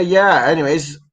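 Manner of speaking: usual speaking voice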